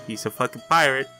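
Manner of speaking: usual speaking voice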